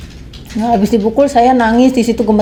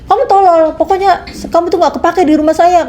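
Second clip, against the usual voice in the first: much higher, sharp mock yell; retold angry yell; 7 semitones higher than usual. Employer yelling at the maid for answering back, imitated much higher. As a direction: snap it like a furious boss.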